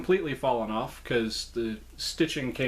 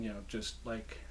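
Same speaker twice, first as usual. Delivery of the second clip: ordinary talk, nothing marked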